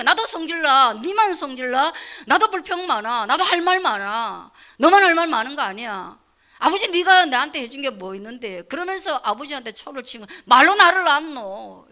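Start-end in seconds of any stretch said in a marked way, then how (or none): 0.0-8.6 put-on louder, indignant child talking back to his father; mock defiant child. Teenage son snapping back at his scolding father, angrily. 10.4-11.9 slow, louder sneering retort; mock retort. Defiant son sneering at his father, slow and loud. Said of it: "이렇게 하는 거예요".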